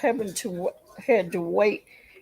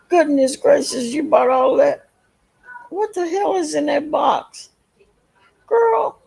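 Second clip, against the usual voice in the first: amazed; 8 semitones higher, 7 dB louder than usual. Real, not acted. Reacting to a huge box.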